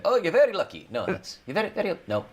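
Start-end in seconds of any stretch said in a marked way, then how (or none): 0.0-0.9 high, loud Irish leprechaun lilt; a failed stab at a leprechaun accent. Pretend. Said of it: "I can't do a leprechaun accent". 1.5-2.0 put-on Indian Irish accent. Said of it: "that was, like, Indian Irish".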